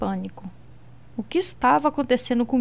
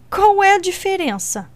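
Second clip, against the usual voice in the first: sarcastic retort; 8 semitones higher, 4 dB louder than usual. Acted. Alina retorting after laugh.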